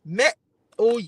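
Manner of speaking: ordinary talk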